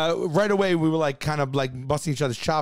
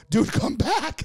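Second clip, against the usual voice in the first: excited plea; 7 semitones higher, much slower than usual. Acted. Calling friend back, astonished.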